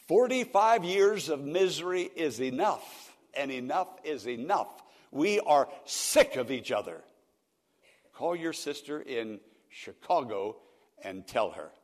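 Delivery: put-on the old man has had enough; exasperated father. Elderly father telling his son by phone, loud and fed up.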